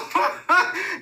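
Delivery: high helpless laugh; real laughter; burst of laughter. Real, not acted. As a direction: crack up at the memory. Laughing at the memory.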